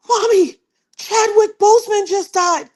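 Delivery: high, loud urgent announcement; urgent news. Retold or imitated. Daughter telling her mother the sad news, high and urgent. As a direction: blurt out the shocking news.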